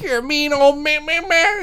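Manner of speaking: very high, whiny nagging caricature; mocking mom impression. Mom refused by the speakeasy bouncer, mocking whine. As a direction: nag in a squeaky mock-mom voice. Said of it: "it's totally like that".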